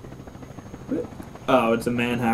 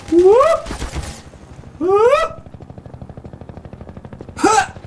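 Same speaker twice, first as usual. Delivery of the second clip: short high whoop and yelps; quick high-pitched exclamations; startled yelps; much slower than usual. Real, not acted. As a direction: yelp high with each near miss. Yelps while playing.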